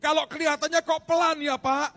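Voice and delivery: loud, high, forceful sermon delivery; fiery preaching. Acted. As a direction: preach it with full force to the congregation. Preacher at full force opening.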